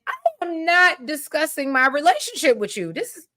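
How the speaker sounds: mildly indignant, high-pitched retort; indignant refusal. Retold or imitated. Chelsea shutting down Allison's question, acted indignant refusal. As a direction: act out putting a nosy ex in her place.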